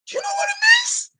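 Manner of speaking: high, cracking shout of outrage; a full angry scream of a question; furious shout. Real, not acted. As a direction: yell it at the viewers in fury. Furious rant about viewers and lions.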